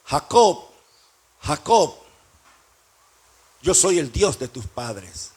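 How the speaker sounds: spaced call by name, sinking low; slow, deep divine declaration; voice of God. Acted. God calls Jacob home, solemn low voice of God. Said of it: "Y Dios le dice".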